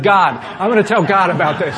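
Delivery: hammed-up righteous threat; mock indignant threat. Pastor joking what he should have told the smug clerk, mock outraged.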